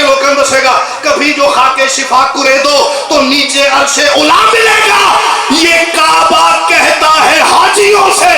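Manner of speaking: loud, rising declamatory cry to the crowd; full-force devotional oratory; impassioned majlis recitation. Acted. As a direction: build the verse into a rousing shout.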